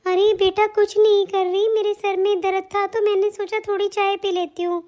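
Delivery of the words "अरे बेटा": ordinary delivery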